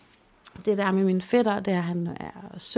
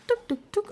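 very high little tap sounds; mimicked tapping; 13 semitones higher than usual. Showing how cousin tapped her cheek, high tap sounds.

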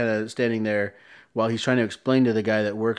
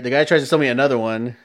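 usual speaking voice

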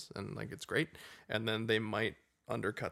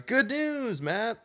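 high hammed-up announcer; mock announcer; 13 semitones higher, 6 dB louder, much slower than usual.

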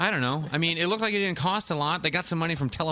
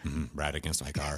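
deadpan monotone, much lower and softer; expressionless deadpan; 11 semitones lower, 7 dB quieter than usual. Retold or imitated. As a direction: say it with no feeling at all. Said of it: "that's how he looks at it".

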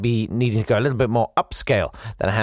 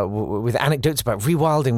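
ordinary talk, nothing marked

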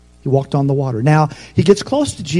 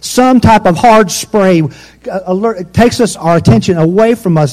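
louder, higher preaching at full force; fiery preaching; 4 semitones higher, 5 dB louder than usual. Acted. Preacher rousing the church about fear. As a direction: drive the point home to the congregation.

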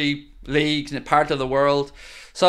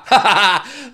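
gleeful laugh; 4 semitones higher, 4 dB louder than usual. Real, not acted. Laughing at rival.

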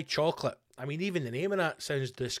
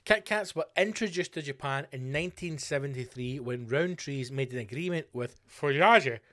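usual speaking voice

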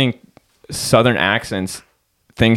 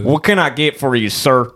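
higher, polite put-on Southern voice; Southern accent; 4 semitones higher than usual. Southerner serving a male customer, higher Southern accent. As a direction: greet a man with a Southern accent. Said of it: "What would a guy say?".

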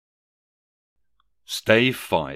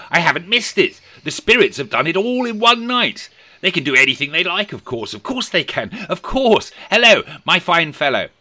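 excited; 10 semitones higher, 7 dB louder than usual. Acted. Scrooge overjoyed at Christmas.

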